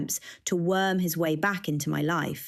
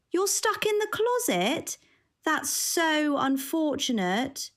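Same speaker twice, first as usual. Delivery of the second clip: sarcastic; 10 semitones higher than usual. A narrator's reading. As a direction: drag out the fake pity. Jill's sarcastic sympathy.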